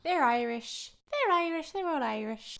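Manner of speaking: mock Irish lilt, played for fun; playful Irish accent. Host riffs to co-host on the Irish cast, playful Irish accent. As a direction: put on a light, joking Irish accent. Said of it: "Should we do the whole podcast in Irish".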